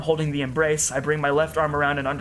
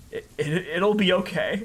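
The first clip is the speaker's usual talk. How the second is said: voice breaking whisper; 4 semitones higher than usual. Acted. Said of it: "My voice breaks and all I can do is whisper".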